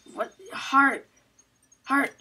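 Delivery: usual speaking voice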